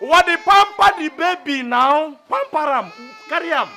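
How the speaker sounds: high, loud scolding; exasperated urging. Acted. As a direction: urge them loudly to soothe the baby. Guard urges carer to soothe baby.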